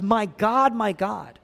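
usual speaking voice

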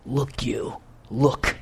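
low, quiet and scornful, LOOK dismissive; insulting tone. Retold or imitated. Elder son berating father in public, low scornful jab. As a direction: brush the father off with contempt. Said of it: "That's, that's the tone that he's talking to his dad".

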